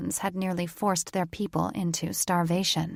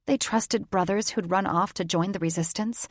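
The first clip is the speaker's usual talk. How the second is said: plain reading voice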